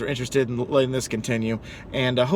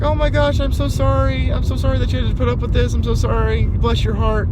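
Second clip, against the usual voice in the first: put-on high-pitched fussing old-lady voice; imitated apologetic old lady; 11 semitones higher than usual. Elderly customer apologizing to driver, imitated high and fussing.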